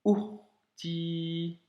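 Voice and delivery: "Ourti" with the "ou" blocked short before the r; "Ourti": "ou", stop, then "rti"; Arabic sukun stop. Shown as a demonstration. Teacher says "Ourti" again as a model, stop after the ou. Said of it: "blocage du ou".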